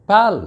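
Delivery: पेल said high and firm, then falling; stressed syllable. Shown as a demonstration. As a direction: punch out पेल on its own. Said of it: "पेल पर जोर है".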